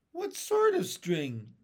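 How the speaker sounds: high, pointed query; sharp question. A narrator's reading. Old grasshopper questioning James, mildly sharp high question. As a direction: demand the detail at once. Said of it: "asked the old green grasshopper sharply".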